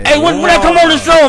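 high, loud excited burst; excited shout. Real, not acted. Hyped guest yelling as he joins the show, loud and high.

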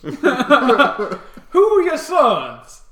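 high, loud, laughing question. Acted. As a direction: laugh through the words.

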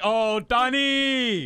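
drawn-out cooing. Retold or imitated. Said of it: "They're like".

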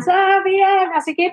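calling her name from across the way like the crowd did; mock shout. Fans at night shouting to the runner, a high called-out name. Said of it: "me gritaban".

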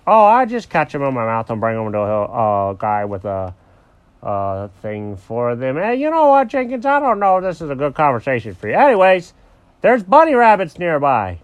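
lightly voiced dog changing the subject; evasive Alfred the dog. Alfred dodges awkward question.

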